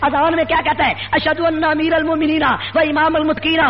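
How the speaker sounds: high-pitched, relentless rally oratory; fiery preaching. Real, not acted. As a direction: build accusation on accusation in rising, driving phrases. Preacher builds a fiery address. (جوشیلی، بلند سُر کی خطیبانہ للکار)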